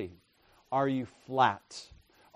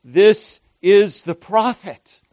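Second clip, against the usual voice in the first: much higher and louder, slowed, in awe; crowd's amazed cry; 7 semitones higher, 11 dB louder than usual. Retold or imitated. Preacher voicing the amazed crowd, loud and high. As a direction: cry out in wonder. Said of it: "they said".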